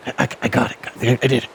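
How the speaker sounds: normal talking voice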